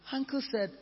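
normal talking voice

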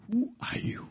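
soft, breathless "Who are you?"; struck-down man whispering in awe; awed whisper. Acted. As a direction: whisper it, overwhelmed. Saul answers the voice, awed.

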